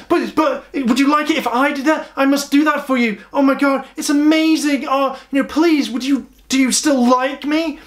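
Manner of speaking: high-pitched, pleading impression of a needy girl; insecure jealous girl. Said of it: "these sorts of insecurities".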